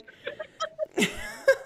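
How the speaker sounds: high, loud burst of laughing; real laughter. Real, not acted. Player laughing at own formatting slip, real laughter. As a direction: crack up at the mistake. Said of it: "I messed up my formatting".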